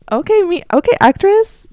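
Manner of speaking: high, bright self-congratulating voice; self-praise. Real, not acted. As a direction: cheer yourself on in a high pitch. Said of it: "praises own acting".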